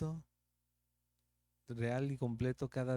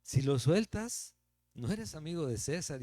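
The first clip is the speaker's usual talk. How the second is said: louder, higher threat to Pilate; crowd threatening; 5 semitones higher, 6 dB louder than usual. Retold or imitated. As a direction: push the crowd's threat at him. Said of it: "decían". (el pueblo amenaza a Pilato, fuerte y agudo)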